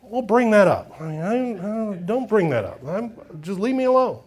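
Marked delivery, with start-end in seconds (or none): none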